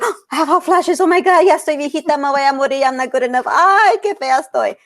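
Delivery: high, breathless mock lament about getting old; mock panicked complaining. Imitating a woman in menopause bemoaning her looks. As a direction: ham up a woman despairing at her own ageing. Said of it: "all of a sudden it's like".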